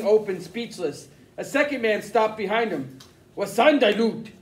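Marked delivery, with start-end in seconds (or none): 3.4-4.3 louder, a sharp angry German question; angry demand. Acted. Intruder demanding answers from Mister Krumm, angrily. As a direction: demand it with a bite. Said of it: "the first man demanded angrily".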